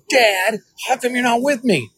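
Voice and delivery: high, pleading daughter's voice; daughter's plea. Retold or imitated. Daughter asking Dad why he isn't beside her, high and pleading. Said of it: "she goes".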